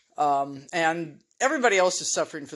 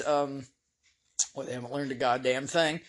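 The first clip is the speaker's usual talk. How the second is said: usual speaking voice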